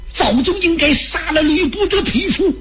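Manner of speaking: high-pitched excited warrior's outburst; hot-headed yelling. A narrator's reading. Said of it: "刘备不让张飞喊了".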